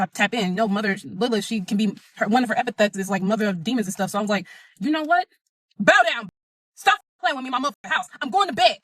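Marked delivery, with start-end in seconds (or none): 4.8-8.8 defiant command. Retold or imitated. Defiant quote told to demon.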